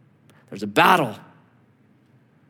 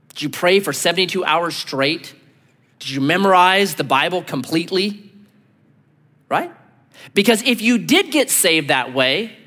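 ordinary delivery

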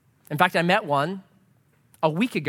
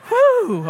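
high, loud exhale of relief; relieved whew; 7 dB louder than usual. Retold or imitated. Pastor relieved the barista knows him, exaggerated whew. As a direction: blow out a big mock sigh of relief. Acting relief at being recognised.

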